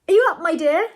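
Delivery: loud, high call through a door. A narrator's reading. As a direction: call to rouse someone. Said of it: "he called".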